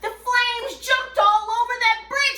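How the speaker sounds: high, loud, dramatic story reading; excited storyteller. A narrator's reading. Storyteller telling children of the burning bridge, excited. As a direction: build the drama of a spreading fire.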